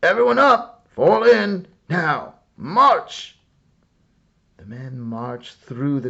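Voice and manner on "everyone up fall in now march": loud, high barked commands; shouted orders. A narrator's reading. Officers rousing sleeping soldiers with shouted orders. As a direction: shout them out of their tents. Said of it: "Some men shouted orders".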